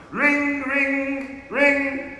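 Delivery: high, drawn-out vocal bell; voiced bell ring. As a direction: imitate a handbell on a high note. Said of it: "this isn't loud enough".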